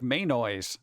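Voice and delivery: "mayonnaise" said slowly as MAY-noise; "mayonnaise" pronounced MAY-NOYZ, slow; mayo-noise. Shown as a demonstration. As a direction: end "mayonnaise" on the word noise. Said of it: "got the word noise in there".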